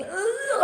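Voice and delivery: hammed-up queasy groan; sick groan. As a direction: groan like you feel sick. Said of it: "felt this sickening".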